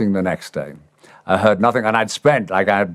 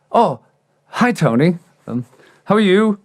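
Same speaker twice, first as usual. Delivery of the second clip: nervous eagerness; 11 semitones higher than usual. Retold or imitated. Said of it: "and I went".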